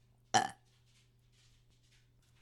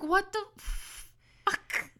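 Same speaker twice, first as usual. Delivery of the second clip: drawn-out baffled what the fuck; 8 semitones higher, 7 dB quieter, much slower than usual. Retold or imitated. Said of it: "I'm like".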